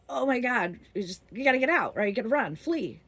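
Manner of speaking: mock-panicked urge to flee; retold panic. Friends warning each other to flee, mock panic.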